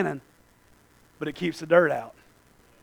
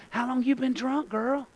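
softer, put-on questioning voice; lightly voiced judging onlooker; 5 semitones higher, 7 dB quieter than usual. Christians questioning somebody in a mess, lightly mocked judging voice. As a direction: bend down to someone with a mild accusing question. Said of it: "we bend down and say".

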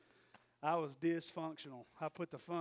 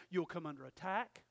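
ordinary talk, nothing marked

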